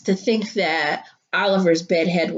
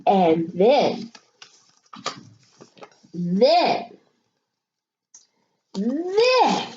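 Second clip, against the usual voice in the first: suspenseful buildup; 2 semitones higher, slower than usual. A narrator's reading.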